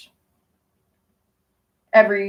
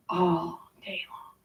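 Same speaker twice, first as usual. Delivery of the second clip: whisper; 4 semitones lower, 12 dB quieter, much slower than usual. Real, not acted. Trailing off in a whisper.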